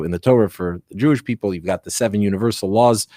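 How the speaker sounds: normal talking voice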